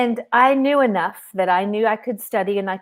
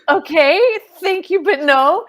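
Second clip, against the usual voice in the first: higher, louder, a stunned polite no; incredulous no thanks; incredulous refusal; 9 semitones higher, 8 dB louder than usual. Retold or imitated. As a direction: turn the offer down in disbelief. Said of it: "And I went".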